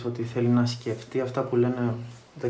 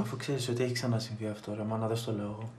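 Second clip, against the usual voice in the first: much softer, resigned; subdued confiding; 9 dB quieter than usual. Acted. Son confiding suspicions to his mother, softly and resigned. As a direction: murmur it as if defeated.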